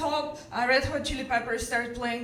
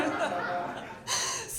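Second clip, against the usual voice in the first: soft laugh after a mock yell; amused laugh at own imitation; real, quiet laughter; 4 semitones higher, 8 dB quieter than usual. Real, not acted.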